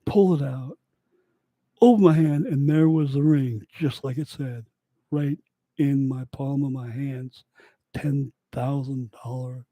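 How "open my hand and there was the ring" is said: ordinary talk, nothing marked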